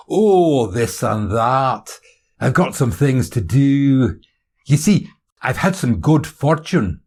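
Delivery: loud, drawn-out Scottish coyness; hint at good news in a Scottish accent; high, slow, teasing Scottish voice. Acted. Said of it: "Is that a Scottish accent".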